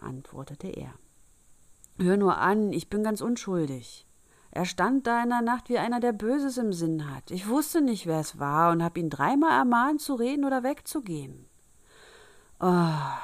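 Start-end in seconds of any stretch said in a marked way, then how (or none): 2.0-11.5 high, loud, earnest self-defence; insistent, agitated explaining; protesting innocence. A narrator's reading. Boy protests his innocence to father. 12.6-13.2 loud, exasperated "Ah"; weary father's outburst; exasperated sigh. A narrator's reading.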